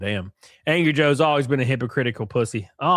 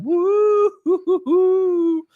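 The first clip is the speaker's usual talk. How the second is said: high, slow mocking woo-hoo; mock cheer; 13 semitones higher, 4 dB louder, much slower than usual. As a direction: hoot it like a taunting fool. Mocking the pretending-meme excuse.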